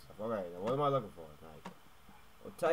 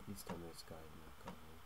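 ordinary talk

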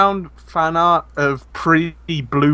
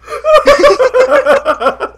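loud, high cackling laugh; uproarious laughter; 8 dB louder than usual. Real, not acted. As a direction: burst out into a piercing laugh. Helpless reaction to picture.

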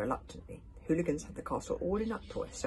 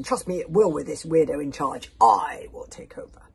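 loud, forceful grumble; grumbling; 12 dB louder than usual. A narrator's reading. Warrior sneering at the weirdo in charge, loud grumbling. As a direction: grumble and stake a claim. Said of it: "grumbled Bagger Bear and the Bear Lady".

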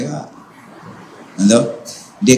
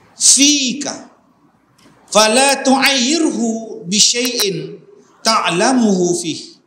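slow, drawn-out chanted Arabic; slow hadith recitation; 6 semitones higher, 4 dB louder, much slower than usual. Acted. Ustaz recites the Prophet's advice in Arabic, slow and chanted. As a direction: recite the hadith slowly and reverently.

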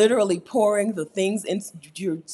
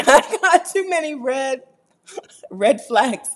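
laughing; 9 semitones higher, 7 dB louder, slower than usual. Real, not acted. Laughing at own red flags.

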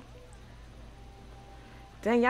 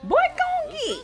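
put-on shrill-pitched call to the boy, much higher; sudden high-pitched holler; high mock yell; 4 dB louder than usual. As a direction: yell it way up high like a scolding auntie. Yelling at sim.

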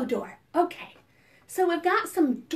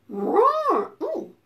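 mock roar; 7 semitones higher, 4 dB louder than usual. Dinosaur roar behind door.

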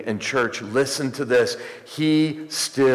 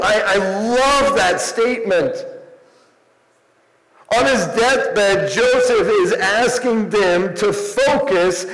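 high, ringing pulpit proclamation; fervent preaching; 12 semitones higher, 5 dB louder than usual. Real, not acted. Preacher proclaiming Joseph's last words, fervent preaching. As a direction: proclaim it with rising fervor, pitched well up.

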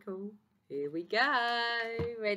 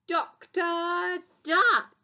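drawn-out, loud book reveal; grand announcement; 10 semitones higher, 7 dB louder, much slower than usual. Real, not acted. Teacher reveals the favourite book to the class, grand announcement. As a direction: stretch the title out like a big reveal.

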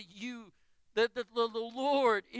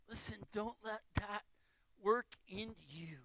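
hushed intimate preaching; 3 semitones lower, 8 dB quieter than usual. Acted. Pastor warning the church against disappointment, hushed and soft. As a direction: draw them in quietly, like a gentle warning. Softly pleads with disappointed listeners.

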